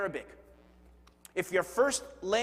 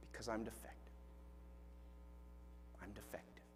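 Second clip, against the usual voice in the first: fading softer murmur of self-blame; self-blaming murmur; 10 semitones lower, 22 dB quieter, faster than usual. Retold or imitated. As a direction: let the words sink away in shame. False self blaming itself.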